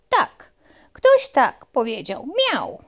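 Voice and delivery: high, loud puppy. Acted. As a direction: tell the mouse eagerly. Puppy answers mouse.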